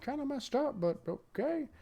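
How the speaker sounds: high, soft mock-timid voice; meek protest. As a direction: object meekly in a small high voice. Voicing others' timid reply to captain.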